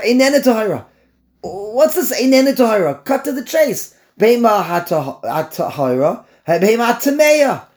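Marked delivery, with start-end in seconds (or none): 1.4-4.0 high, loud, exasperated challenge; impatient objection. Acted. Voices impatient objection to roundabout wording. 4.2-7.7 blunt exclamation. Acted. Blurts the blunt forbidden wording.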